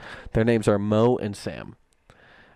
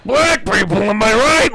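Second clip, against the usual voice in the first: higher, louder hack comic; mock comedian; 10 semitones higher, 7 dB louder than usual. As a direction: do a cheesy stand-up bit.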